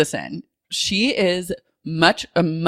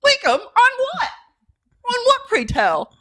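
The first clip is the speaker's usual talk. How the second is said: much higher prissy voice; mock posh lady. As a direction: play an affronted grande dame.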